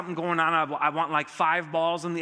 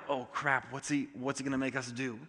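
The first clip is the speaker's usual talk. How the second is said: dreading staff; 4 semitones lower, 7 dB quieter than usual. Retold or imitated. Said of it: "there isn't like".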